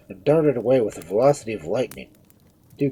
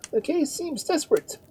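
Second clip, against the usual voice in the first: anxious remark. A narrator's reading. Bystander judging the raving Duke's state.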